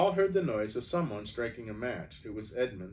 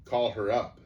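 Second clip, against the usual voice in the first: ordinary delivery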